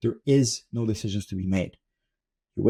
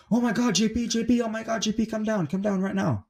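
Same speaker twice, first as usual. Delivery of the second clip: high-pitched mock yell, no louder than talk; imitated yelling; 11 semitones higher than usual. Mom calling son to come down right now, mock yelling. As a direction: imitate someone frantically calling upstairs in a high voice. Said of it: "Somebody was yelling really loud".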